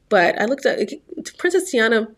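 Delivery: ordinary talk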